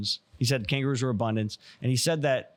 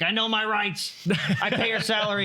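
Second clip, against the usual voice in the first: hammed-up entitled driver, pitched way up; mock yelling; 10 semitones higher than usual. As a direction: yell it indignantly through the window crack, half laughing. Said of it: "start yelling at 'em".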